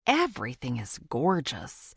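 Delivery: ordinary delivery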